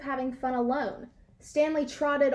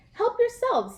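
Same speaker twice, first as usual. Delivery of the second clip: much higher, bright offer; generous invitation; 10 semitones higher, 3 dB louder than usual. A narrator's reading. Dog host lets guests raid the fridge, high and cheery. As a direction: wave them in gladly. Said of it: "said Stanley".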